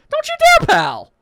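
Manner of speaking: high, loud forbidding yelp; sharp high-pitched protest; alarmed warning. Acted. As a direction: shout to stop them going. Forbids them to check it out.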